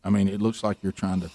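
hushed, low warning from a wife; quiet warning wife. Retold or imitated. Wife quietly warns Rick at the buffet, hushed and low. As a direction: keep it low so nobody hears. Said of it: "she's like".

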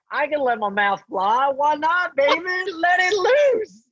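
high, mocking taunt; cocky bravado. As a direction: taunt from a safe distance. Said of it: "So I'm like".

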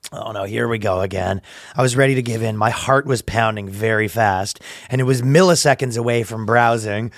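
low, measured storytelling read; dramatic post reading. Acted. Reading a stranger's confession to the co-host, low mock-serious. Reads an online post aloud.

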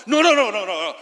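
loud string of no's that trails off; mock refusal. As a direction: shut him down like a know-it-all. Mocking opinionated person.

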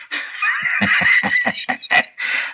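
high, wild cackling laugh; gleeful cackle. Acted. Dying gangster gloating to his son, wild cackle. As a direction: cackle with glee at the plan.

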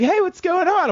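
high, giggly tipsy voice; tipsy giggly girl. Retold or imitated. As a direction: play her tipsy and giggling on the phone. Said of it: "like, little tipsy, like, giggly".

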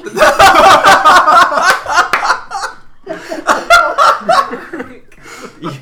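long, high burst of laughing; real big laugh; loud laughter. Real, not acted. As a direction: crack up helplessly. Table laughs at the scream.

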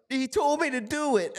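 very high put-on voice; mock whiny blame. Mocking the hat thrower blaming someone, high squealed excuse. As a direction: squeal it like a tattletale.

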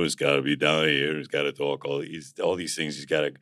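low Rocky voice, pitched well down. Shown as a demonstration. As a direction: drop down low into a Rocky impersonation. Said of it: "speaking this voice".

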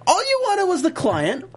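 high incredulous rant; can't believe her, complain high; exasperated rant. Real, not acted. Ranting at the ATF agent on TV.

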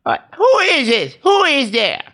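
high, suspicious grandma voice; Sandler's grandma. Acted. Grandma answering the apartment buzzer, high querying old-lady voice. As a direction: pitch it way up like a grandmother. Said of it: "she's like".

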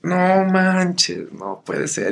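high, shocked cry fading to an unvoiced breath; a mock gasp of disbelief that the discs are gone; dismayed disbelief. Singer realizing he sold every disc, high mock dismay.

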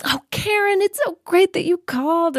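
high, bright fake-cheery phone greeting; mom's phone voice. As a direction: switch to a sudden sugary voice for the caller. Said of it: "her voice would be really happy".

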